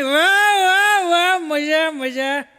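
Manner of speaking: loud slurred wah wah cheers; drunk cheering. Retold or imitated. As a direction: cheer like a tipsy fan in the crowd. Drunk audience cheering loudly. (નશામાં જોરથી વાહ વાહ)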